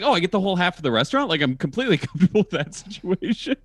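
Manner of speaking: high, fast and laughing; excited laughing. Retold or imitated. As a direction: gush with delight, laughing through it. Retold delighted reaction, laughing.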